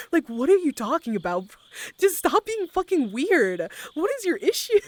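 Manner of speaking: acted-out indignant outburst, high and loud; exasperated. Confronting the man who baited her, exasperated. Acted quarrel with pushy clubber.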